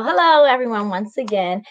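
plain reading voice

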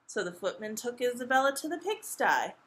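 ordinary delivery, nothing marked

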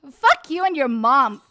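loud, punched insult; angry outburst. Real, not acted. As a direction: snap it loudly at an opponent. Swearing at game opponent.

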